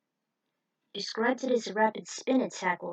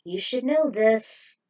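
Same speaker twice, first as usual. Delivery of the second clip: ordinary delivery, nothing marked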